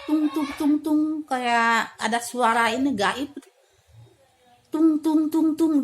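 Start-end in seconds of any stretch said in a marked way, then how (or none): 0.1-1.0 higher-pitched "tung" bounces imitating a spring; bouncy mimicked boings on "tung"; spring bouncing noise. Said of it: "kayak per gitu". 4.7-5.8 high, loud "tung" hops; punchy mimicked bounces, higher and louder; hopping noise. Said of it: "loncat-loncat gitu ya".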